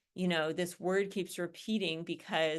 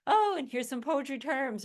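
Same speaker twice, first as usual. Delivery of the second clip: a light, higher, tossed-off voice for a student dumping terms at the end; mock afterthought; 6 semitones higher, 4 dB louder than usual. Teacher mimics a student tacking poetry terms on at the end, a light mocking afterthought. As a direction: play a careless student tacking terms on last. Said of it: "or something like".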